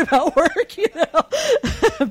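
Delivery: amused disbelief breaking into laughter; laughing. Real, not acted. Recalling her husband's obliviousness, laughing.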